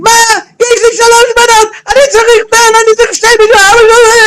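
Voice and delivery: high screamed Hebrew complaint; screaming. Retold or imitated. Old father to the nurse, screaming high in protest. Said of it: "And he starts screaming".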